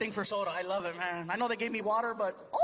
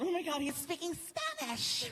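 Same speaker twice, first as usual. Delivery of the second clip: mock shocked onlooker; 8 semitones higher, 4 dB quieter than usual. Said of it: "people freaked out by Spanish".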